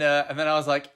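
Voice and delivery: ordinary talk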